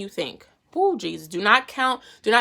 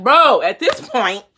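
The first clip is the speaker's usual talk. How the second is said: exasperated God; 10 dB louder, slower than usual. Retold or imitated. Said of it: "God was getting mad".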